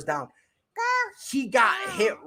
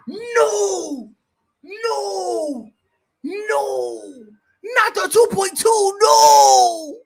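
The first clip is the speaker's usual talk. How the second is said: high, loud despairing cries of no; anguished nos; 9 semitones higher, 6 dB louder, much slower than usual. Real, not acted. Streamer watching fantasy points stall, despairing high wails. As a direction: wail at the screen in despair.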